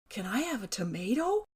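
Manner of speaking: wheedling little question with a big final rise; hopeful kid's request, lilting upward; boy asking. Retold or imitated. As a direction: imitate a neighbour boy asking for a treat. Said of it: "and asked".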